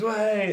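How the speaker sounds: a sudden high "Way!" like a badly timed crowd sample; mock crowd cheer. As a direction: shout it out like a misfired playback cheer. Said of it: "and it goes".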